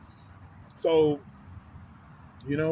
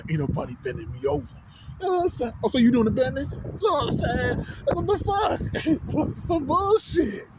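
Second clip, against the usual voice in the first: mock indignant retort; 8 semitones higher than usual. Mock retort to homie, high.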